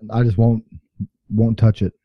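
usual speaking voice

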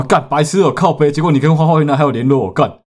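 high, loud disbelieving rant; loud incredulous cursing; exasperated outburst. Retold or imitated. Exasperated outburst retelling reaction.

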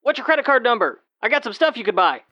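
high, pushy street hawker; through a megaphone. Pretend. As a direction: call out to a passerby. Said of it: "standing on the corner with a megaphone".

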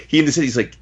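usual speaking voice

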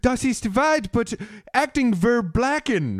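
high-pitched fake German; mock German assassin. Comic voicing confused German assassins, mock German accent. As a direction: babble high, made-up German in a put-on accent. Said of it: "my German assassin impersonation".

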